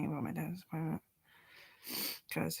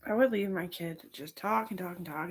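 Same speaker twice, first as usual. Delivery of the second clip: ordinary talk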